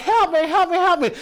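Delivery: high, hammed-up cries for help; mock panicked pleading. Imitating passengers as water pours in, mock panic. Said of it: "they're all like".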